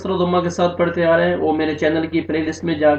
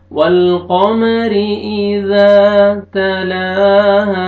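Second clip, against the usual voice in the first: slow, louder chanted tilawat; Quran recitation; 4 semitones higher, 6 dB louder, much slower than usual. Shown as a demonstration. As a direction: draw each syllable out. Chanted model. (धीमी, ऊँची तिलावत)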